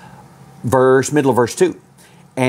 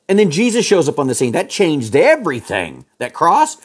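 rising preaching fervour; 7 semitones higher than usual. Real, not acted. Preaching climax on the cross.

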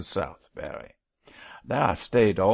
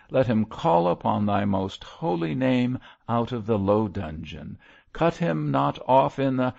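raised, solemn preacher's plea; fervent prayer; 5 semitones higher than usual. A narrator's reading. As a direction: implore God for a lost sinner. Baltic's prayer resumes.

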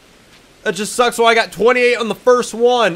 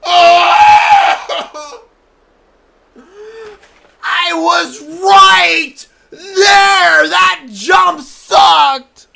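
very loud, much higher raging screams; furious screaming; 8 semitones higher, 8 dB louder, much slower than usual. Real, not acted. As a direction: scream in despair at the failed jump. Falls near goal in game.

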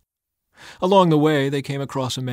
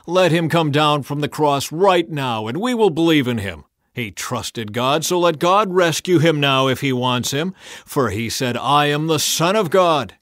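loud, higher mocking taunt; scoffing; 5 semitones higher, 7 dB louder than usual. A narrator's reading. Elders mocking Jesus on the cross, loud scoffing taunt. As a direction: dare him to come down, sneering. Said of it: "they scoffed".

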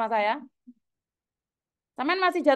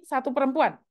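usual speaking voice

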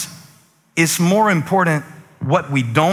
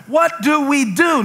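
panicked shout; 11 semitones higher than usual. Retold or imitated. Servant crying out in panic.

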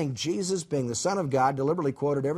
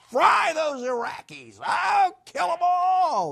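much higher, slow, shouted demand; vengeful shout; 3 dB louder, slower than usual. Retold or imitated. Jonah demanding God destroy Nineveh, slow high shout. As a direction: yell for the city's destruction. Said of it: "That was Jonah's attitude".

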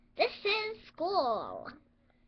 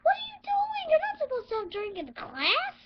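shrill angry scolding; 12 semitones higher, 5 dB louder than usual. Acted. Teacher scolds drink in class.

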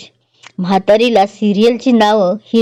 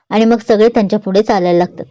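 ordinary delivery, nothing marked

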